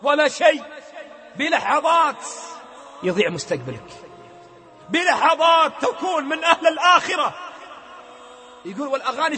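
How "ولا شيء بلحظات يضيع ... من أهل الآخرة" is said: rising, urgent sermon voice; fervent preaching. Acted. The preacher admonishes the young, fervent. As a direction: warn them with fire.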